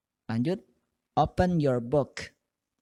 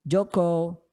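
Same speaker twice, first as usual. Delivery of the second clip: Joko called out raised and loud, present answered high; teacher calling roll; 5 semitones higher, 3 dB louder, slower than usual. Retold or imitated. Teacher calling the roll to her class. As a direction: call the name Joko like taking attendance.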